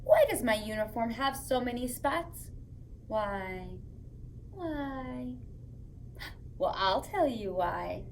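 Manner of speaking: gentle storytime read-aloud; reading to children. Acted. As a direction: read it like a bedtime book to little kids. Reads picture book to children.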